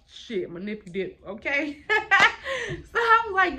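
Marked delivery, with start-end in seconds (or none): 1.8-2.6 high burst of laughter. Real, not acted. Laughing at own word struggle.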